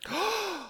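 high mock gasp; dramatic gasp. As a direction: gasp theatrically. Mock gasp at plot turn.

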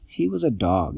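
plain reading voice

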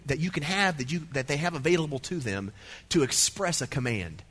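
normal talking voice

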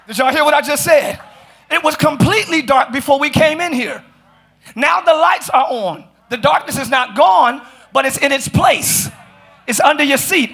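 emphatic, projected sermon voice; fired-up preaching. Real, not acted. Preacher showing congregation where darkness belongs, fired-up preaching. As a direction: build momentum as you preach.

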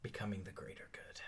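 soft, low whispery delivery; hushed cultist reply. Acted. Angelo answering Jose about his cause, hushed whisper. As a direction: breathe it out quietly and solemnly.

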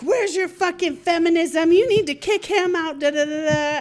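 high-pitched mock tirade; retold angry yelling. Woman confronting her finger in face, imitated yelling. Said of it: "she's yelling, finger in the face, yelling at me".

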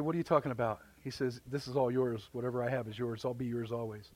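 softly, gently reassuring the elder son; a father quietly comforting his son; the father answering his jealous son in a hushed, tender voice. Retold or imitated. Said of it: "He said".

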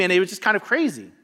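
ordinary talk, nothing marked

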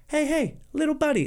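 high, sing-song friendly "hey"; a tap on the shoulder in voice; friendly nudge. Pretend. Voicing the polite horn.

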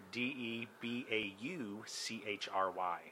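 slow letter by letter; spelling it out. Shown as a demonstration. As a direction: spell each letter slowly. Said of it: "Can you use it in a sentence?".

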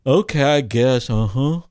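low, bored, half-hearted okay; lightly voiced disinterested teen; mumbled teen reply. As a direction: shrug it off like a clueless kid. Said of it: "looks at me and says".

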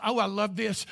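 ordinary delivery

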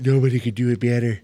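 mock Trump bragging; Trump impression. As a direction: brag like Trump. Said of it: "Yeah, you like this".

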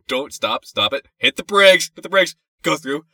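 from hesitant to yelled orders; building panicked commands. Retold or imitated. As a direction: start unsure, end shouting. Yelling driving commands in panic.

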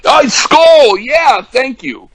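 high, loud burst of recognition; delighted recall. Real, not acted. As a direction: exclaim as the forgotten name comes back. Suddenly remembers the forgotten name.

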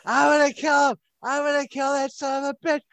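high-pitched furious threat; enraged woman. Acted. Woken woman raging about Ted, high and furious.

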